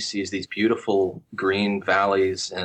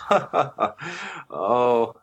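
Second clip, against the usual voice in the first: laughing it off; 3 semitones higher than usual. Retold or imitated. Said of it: "he had clearly laughed".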